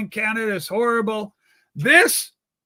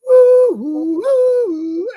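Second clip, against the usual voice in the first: high, loud hooting flute noises; mock flute hoots, way up high; imitating a flute; 10 semitones higher, 9 dB louder, much slower than usual. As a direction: toot like a little baby flute. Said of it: "and he's going".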